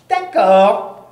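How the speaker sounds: loud witch voice agreeing; witch agrees. Acted. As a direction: witch agrees to wait, in her witch voice. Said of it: "me dit la sorcière".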